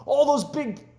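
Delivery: high, loud and thrilled; delighted. A narrator's reading. Toad telling Frog his hat fits, delighted. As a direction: burst with joy at the news.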